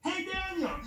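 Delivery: high storyteller's holler without real volume; mock shout. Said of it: "shouts in".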